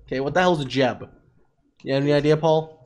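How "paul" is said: ordinary talk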